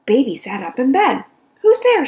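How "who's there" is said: high loud startled call. Acted. Baby woken in bed calling out in fright. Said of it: "she said".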